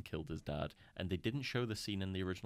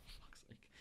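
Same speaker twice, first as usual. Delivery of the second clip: soft whispered curse; whispered aside; 16 dB quieter than usual. Real, not acted. Retelling the scene, whispering aside to friends. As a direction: breathe it out under your breath.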